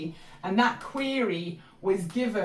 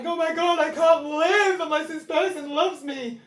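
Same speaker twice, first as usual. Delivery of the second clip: desperate dependence; 7 semitones higher, 4 dB louder than usual. Retold or imitated. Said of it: "'cause I was like".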